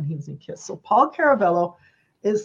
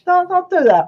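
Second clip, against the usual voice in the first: half-hearted New York voice, high and loud; a rough try at a New Yorker, pitched up; lightly done New York outburst; mock New York accent; 9 semitones higher, 7 dB louder than usual. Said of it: "I can't do the New York accent".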